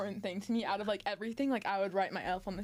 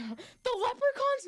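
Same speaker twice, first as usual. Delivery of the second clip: slow, very high questioning voice; childish hopeful question; 13 semitones higher, slower than usual. Retold or imitated. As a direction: ask hoping this one is real. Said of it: "and I'm like".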